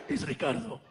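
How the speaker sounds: low, sultry soap-opera leading man; a deep, smoldering telenovela voice; telenovela hunk. Retold or imitated. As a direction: purr it like a novela lover. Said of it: "sound like you're in one of those novelas".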